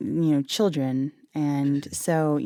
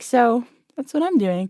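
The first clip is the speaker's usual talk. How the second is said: little brother's answer; 6 semitones higher than usual. Retold or imitated. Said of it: "He's like".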